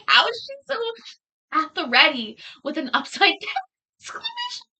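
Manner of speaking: high-pitched, laughing mock amazement; amused disbelief. Streamer, amazed by a defendant, laughing in disbelief.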